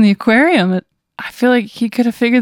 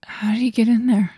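whispered, voiceless question; hushed whisper to oneself; spooked whisper; 4 dB quieter than usual. Pretend. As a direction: whisper it as if he might hear. Player reacts to man in back seat.

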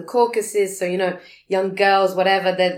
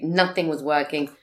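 ordinary talk

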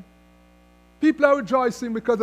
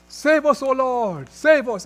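loud, raised preacher's declaration; declaring plea; 3 semitones higher, 6 dB louder, slower than usual. Retold or imitated. Preacher quoting the prophet's psalm, declaring it loudly. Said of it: "the prophet declares".

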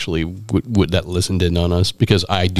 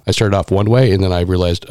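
ordinary delivery

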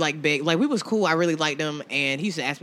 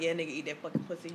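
soft, sultry, fast murmur; 14 dB quieter, faster than usual. Retold or imitated. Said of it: "sultry, like".

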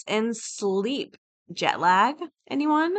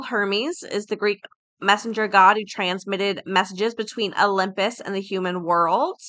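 ordinary talk, nothing marked

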